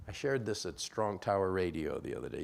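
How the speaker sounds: ordinary delivery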